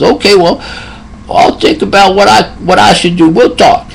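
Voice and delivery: high, lightly put-on old man's voice; elderly patient. Old patient quoted by nurse.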